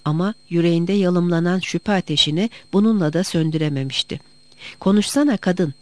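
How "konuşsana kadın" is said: ordinary delivery, nothing marked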